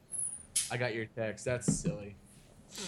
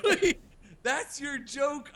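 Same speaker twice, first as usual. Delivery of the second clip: high, disbelieving outburst, then a slow drawn-out question; incredulous; 12 semitones higher, slower than usual. Retold or imitated. Dad confronting his daughter over the marshmallow prank, incredulous. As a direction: throw back stunned disbelief at the prank.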